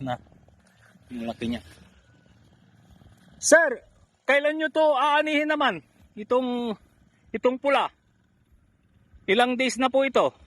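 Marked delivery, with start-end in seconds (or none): 3.4-10.3 loud, high-pitched call to someone. Real, not acted. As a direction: raise your voice to ask from a distance. Calling out a question to farmer. (malakas na pagtawag)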